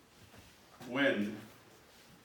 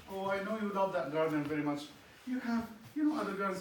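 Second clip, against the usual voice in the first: higher, coaxing voice; coaxing wife; 9 semitones higher than usual. Acted. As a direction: humour him like a child. Jezebel coaxes the king.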